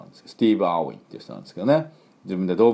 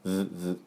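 two soft short "vvv" buzzes; buzzed V; 8 dB quieter than usual. Shown as a demonstration. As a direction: buzz twice like a vibrating phone. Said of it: "携帯バイブ". (携帯バイブのように「ヴ、ヴ」)

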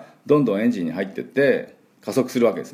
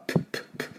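声を出さずに唇で「プッ」と三回; スイカの種を飛ばすように短く息を破裂; 種を飛ばす音; 9 dB quieter, much faster than usual. Shown as a demonstration. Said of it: "スイカの種を飛ばす音ですね". (息だけのPの破裂音)

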